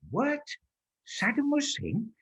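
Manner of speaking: high rising mock disbelief; a room full of puzzled diners; baffled. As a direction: imitate the whole room's confused double take. Crowd's baffled reaction, acted.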